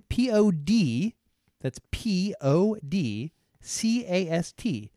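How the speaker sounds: letters spelled out slowly, one by one. Shown as a demonstration. Host spelling podcast for confused listeners, slow and deliberate. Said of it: "people don't understand how to spell podcast".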